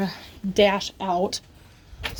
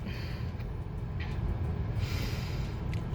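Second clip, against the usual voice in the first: frustrated sigh; 14 dB quieter than usual. Real, not acted. Frustrated at Target.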